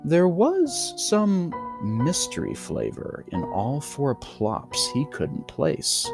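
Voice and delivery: ordinary delivery, nothing marked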